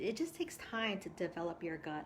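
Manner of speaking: ordinary talk, nothing marked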